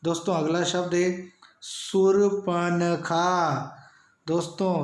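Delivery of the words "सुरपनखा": सुरपनखा drawn out very slowly; slow spelling-lesson read of सुरपनखा; slow demo word. Shown as a demonstration. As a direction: say सुरपनखा syllable by syllable. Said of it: "सर्वाधिक गलत लिखा जाने वाला शब्द".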